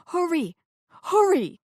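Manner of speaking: high, loud hurry; urgent yell. Acted. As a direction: push them to run. Urging helpers on.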